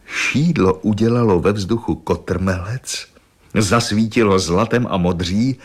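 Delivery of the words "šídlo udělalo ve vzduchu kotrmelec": usual speaking voice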